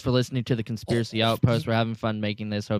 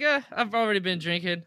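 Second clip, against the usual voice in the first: mock high Matt voice; 8 semitones higher than usual. Retelling Matt's drinking at work.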